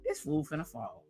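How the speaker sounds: hushed retold reaction; muttered aside. Vlogger recalls watching Al on the tractor, muttered aside.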